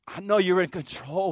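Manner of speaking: lifted, earnest voice of a praying believer; mock prayer. Preacher voicing a believer's prayer, lifted and earnest. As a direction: pray it like you mean it. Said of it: "we pray".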